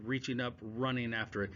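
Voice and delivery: usual speaking voice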